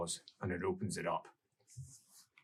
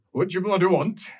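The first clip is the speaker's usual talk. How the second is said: higher, louder, harried bureaucrat; stressed official; 6 semitones higher, 4 dB louder than usual. Acted. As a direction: snap like an overworked clerk.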